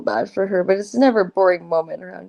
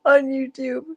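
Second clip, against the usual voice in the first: laughing; 5 semitones higher than usual. Real, not acted. Laughing while quoting herself.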